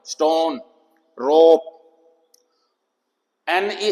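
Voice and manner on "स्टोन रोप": स्टोन, रोप with a long rounded O; long O sound. Shown as a demonstration. Teacher giving long-sound examples, long O. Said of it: "अब लंबा हो गया sound".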